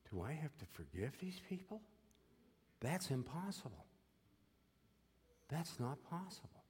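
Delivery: high, soft, pleading voice; humble plea to God. Retold or imitated. Prisoner humbly asking God if he must forgive, high and soft. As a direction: plead upward in disbelief, hushed. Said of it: "humbly said to God".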